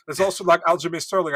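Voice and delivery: usual speaking voice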